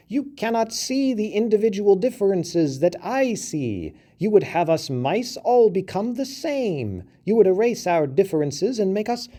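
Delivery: high, squeaky voice of a little mouse; a small mouse scolding a big elephant; indignant mouse. A narrator's reading.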